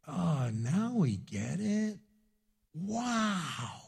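slow, drawn-out mock realization; dawning understanding. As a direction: stretch it out in hushed, acted wonder. Acting disciples finally understanding parable.

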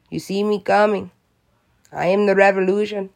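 hammed-up Mexican accent; bad Mexican accent. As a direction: put on a clumsy Mexican accent, proudly listing boasts. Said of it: "that was a bad Mexican accent".

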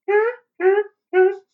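high-pitched hammed-up ha ha; high mocking laugh. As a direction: cackle the lyric up high. Mocking the song's delivery.

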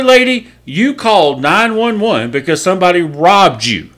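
hammed-up furious cop scolding a woman; imitating furious cop. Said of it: "He's so mad".

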